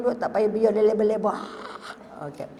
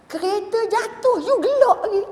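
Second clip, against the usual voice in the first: incredulous boss; 11 semitones higher than usual. Retold or imitated. Boss reacts to laughing teacher.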